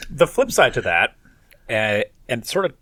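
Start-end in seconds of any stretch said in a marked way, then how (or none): none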